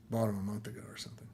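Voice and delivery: quick whispered aside under breath. Real, not acted. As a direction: drop to a fast whisper, as if to self. Quick mumbled aside about purchase.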